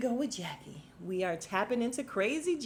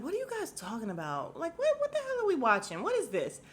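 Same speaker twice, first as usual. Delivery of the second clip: incredulous viewer reaction; baffled disbelief; 9 semitones higher than usual. Retold or imitated. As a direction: voice jumping high on "what the hell". Retold baffled reaction to boring date.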